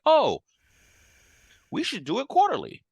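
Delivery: very high, loud acted eureka; mock sudden realization. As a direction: act out a bright idea striking, way up high. Acting out their sudden shared idea.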